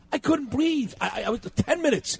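high-pitched, insistent complaint; exasperated protest. Real, not acted. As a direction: swear it was unbearable. Protesting how bad the mask was.

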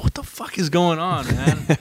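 high, startled what the fuck; shocked outburst. Retold or imitated. Returning from the bathroom to chaos, startled outburst.

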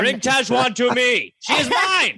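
mock demanding shout, pitched high; hammed-up possessive cry; commanding suitor. Cohost playing a take-charge man claiming Tajuan, commanding mock shout.

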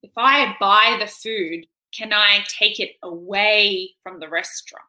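slow, careful over-explaining. Retold or imitated. Explaining takeaway to a puzzled waitress, slow and careful. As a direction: spell it out slowly for a confused waitress. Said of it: "And I was like".

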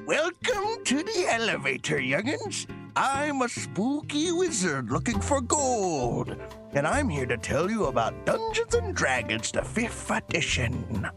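creaky old miner playing wizard; hammy old miner who thinks he's a mage; old prospector. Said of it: "you're an old miner who thinks he's a mage".

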